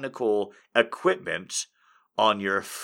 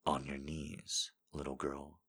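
seductive voice; 8 semitones lower, 14 dB quieter than usual. Pretend. Reading a viewer quote in a low sultry voice. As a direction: purr it low and intimate. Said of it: "And then I say it".

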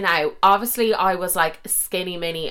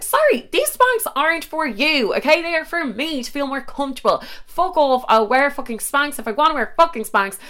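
indignant snap; 8 semitones higher than usual. Retold or imitated. Snapping back at boyfriend in quote.

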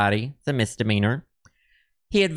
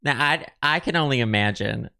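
usual speaking voice

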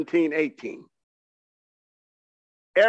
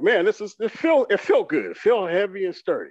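higher-pitched, eager delight; excited; 5 semitones higher than usual. Retold or imitated. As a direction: retell the thrill of a new gadget. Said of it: "I'm just so excited".

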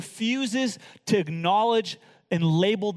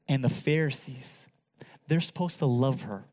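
tender soft preaching; 9 semitones lower, 7 dB quieter than usual. Acted. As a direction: lean in and comfort her gently.

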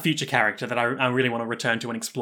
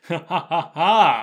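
spaced-out mock "ha ha ha"; acted villain laugh; 3 dB louder, slower than usual. Villain Angelo gloating at his luck, acted laugh. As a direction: laugh deliberately, syllable by syllable. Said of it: "from the bottom of my heart and laugh".

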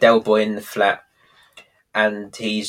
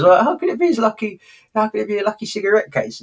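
quoting Rodney, pitched much higher and fast; rising, laughing Rodney; 10 semitones higher, faster than usual. Retold or imitated. Friend retelling Rodney's baffled question, high and fast. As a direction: squeak it out fast through a laugh. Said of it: "Rodney goes".

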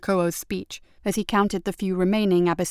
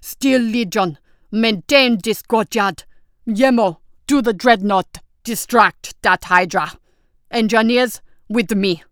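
loud commanding battlefield shouts; shouting orders; 2 semitones higher, 5 dB louder, slower than usual. Acted. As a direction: bark orders over gunfire. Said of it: "shouted orders over the clamour".

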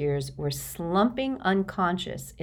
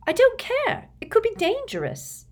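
much higher, loud outraged protest; 4 dB louder than usual. A narrator's reading. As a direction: cry out in alarm. Hermione outraged.

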